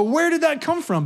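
high, surprised question. Retold or imitated. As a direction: act innocently caught off guard. Feigned surprise at consequences.

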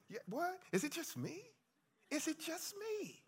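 high, soft, teasing disbelief; mock surprise. Real, not acted. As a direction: pretend shock at the quiet crowd.

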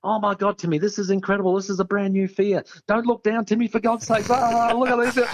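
high, breathless excited babble; a mate gushing without a pause; nonstop chatter. Retold or imitated. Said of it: "he will not shut up talking".

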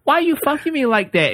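high female voice. Retold or imitated. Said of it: "And then she says".

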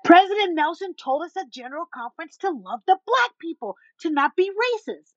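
high, proclaiming mimicry; imitating a loud, zealous stranger; loud, animated. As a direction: announce it high and fervent to the whole store. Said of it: "And she's loud".

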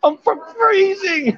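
hammed-up shivering wife; mock freezing wife. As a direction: squeak it out like a frozen wife. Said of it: "She went".

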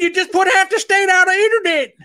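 high, loud scolding; mock yell. Boss scolding Leroy over the cut wire, in a high mock yell. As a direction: yell it like a panicked foreman.

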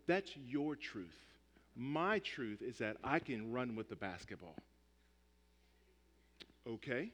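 a player coolly lowering his voice to dismiss the ref; smug relativist. Retold or imitated. Player mocks relativist truth claim.

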